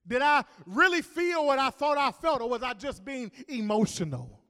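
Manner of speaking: preacher voicing a desperate believer's doubts; doubting believer. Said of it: "went something like this".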